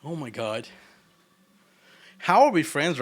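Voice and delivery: normal talking voice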